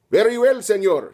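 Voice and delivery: lightly voiced Spanish soldier; Spanish accent. Fascist soldier trying a mild Spanish accent at last. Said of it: "realized he was supposed to be Spanish".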